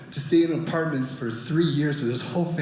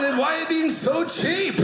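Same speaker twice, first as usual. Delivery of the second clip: high, drawn-out mock outrage; indignant demand; 9 semitones higher than usual. Comic to millionaire friend after the gift, indignant demand. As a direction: demand it slowly in a much higher voice. Punchline protest to rich friend.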